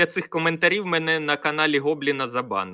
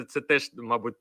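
usual speaking voice

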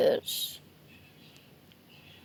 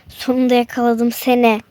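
lower, menacing kidnapper; a low, menacing villain catching his victim; 6 semitones lower than usual. Acted. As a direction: growl it like a gloating villain. Villain grabs the little bear.

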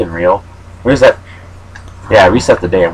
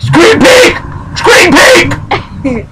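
excited accusation; much slower than usual. Real, not acted. Accuses friend of peeking.

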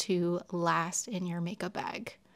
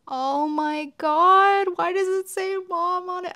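high, loud, slow cry of emotion; overwhelmed, near tears; about to cry; 11 semitones higher, 9 dB louder than usual. Real, not acted. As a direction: voice breaking with sudden tears. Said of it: "I'm gonna freaking cry".

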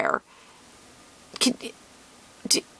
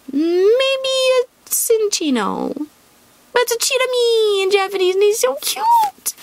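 cooing voice; 10 semitones higher than usual. Real, not acted. Cooing at the Cinccino card on camera.

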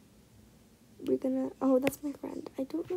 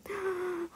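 high, soft gasp; excited gasp; 6 semitones higher, 8 dB quieter than usual. Real, not acted. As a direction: gasp at a dream find. Spots a much-wanted penguin.

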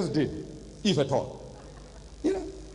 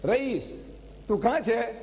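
higher, sing-song "Rais, Rais" calling out; playful calling; 5 semitones higher, slower than usual. Retold or imitated. Grandpa plays hide and seek with grandson, playful high calling. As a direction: call for a hiding grandson, playful.

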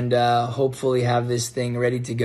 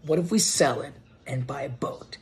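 whisper; 5 dB quieter than usual. Retold or imitated. Pitching boat idea to wife.

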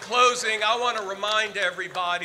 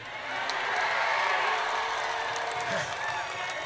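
crowd cheering. Real, not acted. Crowd at a parade rally.

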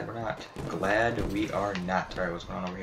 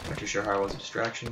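normal talking voice

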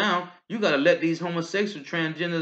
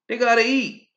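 higher, louder punch line; higher, louder preacher's punch; loud preaching; 8 semitones higher, 7 dB louder than usual. Real, not acted. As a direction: shout it out to the crowd. Preaching climax.

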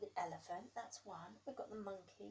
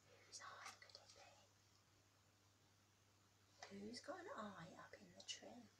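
fast, breathy hushed whisper; suspense whisper; 10 dB quieter than usual. A narrator's reading. Reader building suspense for the child, whispered.

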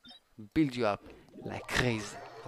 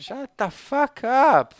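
much higher, louder, slow; angry yell; 12 semitones higher, 10 dB louder, slower than usual. Real, not acted. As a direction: snap at the character. Yells at game voice.

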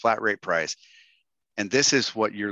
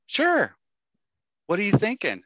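a forced, bright-pitched high answer; overeager reply; 10 semitones higher than usual. Retold or imitated. Retelling his reply to Barb Cochran, high and eager. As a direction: jump high on Sure, then settle. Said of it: "And so I'm like".

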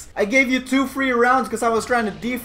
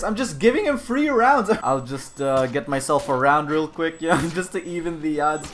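ordinary talk

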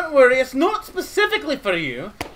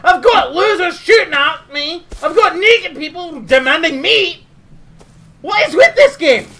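loud, raised-voice rant; exasperated outburst; 3 semitones higher, 7 dB louder than usual. Pretend. Complaining of shooters and naked beggars, loud exasperated rant. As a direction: explode in comic outrage.

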